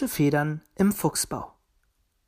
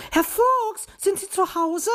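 high-pitched bird calling out; calling at the door; 3 dB louder than usual. A narrator's reading. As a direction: call out high for someone at home. Magpie's usual call at door. (mit hoher Stimme rufend)

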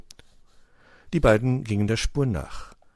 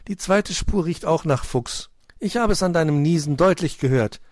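high, loud teasing storybook hare; hare Hoppel, amused; 9 semitones higher, 6 dB louder than usual. A narrator's reading. Hare teasing the sneezing cat, amused. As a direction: tease your friend in a raised hare voice.

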